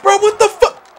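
high, loud burst of disbelief; outraged yell. Real, not acted. Gamer baffled by the match, outraged high yell.

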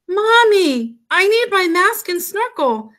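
put-on high childlike call to Mommy; urgent plea. A narrator's reading. Froggy almost sinking calls mother, urgent high plea.

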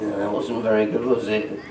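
normal talking voice

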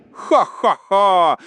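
slow, spaced-out "ha-ha-ha"; high, deliberate mock cackle; gloating monster laugh. A narrator's reading. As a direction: boom out a triumphant stage laugh, slowly. Trapped monster leaps out gloating.

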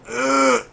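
short, loud grunt of effort; exasperated "ugh"; frustrated grunt. Real, not acted. Struggling to control the cart.

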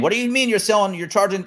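high mock outraged fan; mock angry fan. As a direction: whine like a mock upset fan. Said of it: "the bands get blamed".

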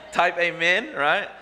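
loud, high call through laughter; amused, laughing. Real, not acted. Pastor teasing church singles about spouses, laughing. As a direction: laugh while urging the crowd on.

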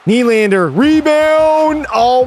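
rising to a high, loud cry; excited call. Real, not acted. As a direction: call the rebound like it's going in. Late chance, player calls out excitedly.

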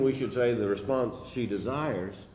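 ordinary delivery

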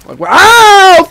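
high, loud, stretched-out wail; loud, rising outburst; loud wow. Real, not acted. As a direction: blow the WOW up into a long yell. Same reaction blown up loud.

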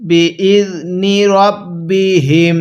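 বি ইজ নি রব বি হিম chanted; tajweed chant; slow recitation. Shown as a demonstration. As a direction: recite syllable by syllable. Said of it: "কিভাবে শিখব".

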